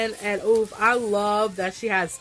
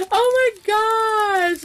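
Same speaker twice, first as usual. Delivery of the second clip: excited squeal; 10 semitones higher, 5 dB louder, slower than usual. Real, not acted. Opening gift, squealing.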